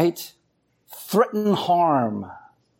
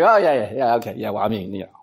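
sheepish brush-off; 10 semitones lower, much faster than usual. Retold or imitated. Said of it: "And I'm like".

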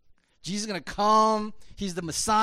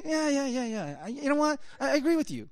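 higher-pitched, mock quick agreeing; glib agreement; 6 semitones higher, faster than usual. Preacher mimicking listeners who like that voice, glib agreement. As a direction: brush it off with easy agreement.

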